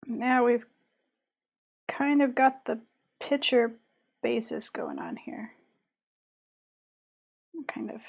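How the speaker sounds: muttering under the breath; half-whispered thinking aloud; quiet narrating. Real, not acted. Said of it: "I stop talking to y'all and start narrating my choices".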